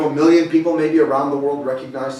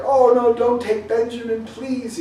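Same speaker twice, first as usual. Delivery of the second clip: high, pleading cry, hammed-up a little; mock-beg him to spare the youngest; 8 semitones higher than usual. Brothers begging Joseph to spare Benjamin, mock pleading.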